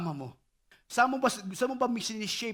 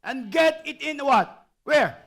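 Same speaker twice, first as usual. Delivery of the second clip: reading scripture aloud; 4 semitones higher, 8 dB louder, slower than usual. Acted. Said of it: "Can we read this together?".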